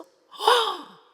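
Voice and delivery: sharp high gasp of shock. Retold or imitated. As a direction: gasp as if caught out. Girl gasps in shock. (깜짝 놀란 헉 소리)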